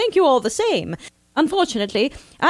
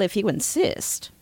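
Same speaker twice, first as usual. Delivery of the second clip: ordinary talk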